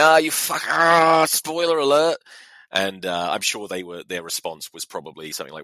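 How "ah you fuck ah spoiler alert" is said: loud, high mock howl; outraged outburst. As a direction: re-enact a cry of protest. Retold outburst at spoiled score.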